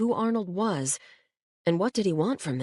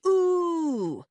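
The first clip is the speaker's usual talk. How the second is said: yelled ooh; 12 semitones higher than usual. Acted. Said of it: "Tommy and Luis yelled".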